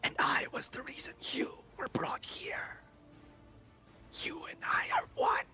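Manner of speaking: whispered, high demonic entity. Acted. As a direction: breathe it like an ancient demon. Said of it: "Let me do that again for our viewers".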